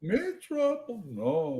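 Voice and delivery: put-on high Yiddish-accented denial. Hobbit denying he's trouble, high mock Yiddish accent. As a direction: squeak up in mock innocence, Yiddish-style.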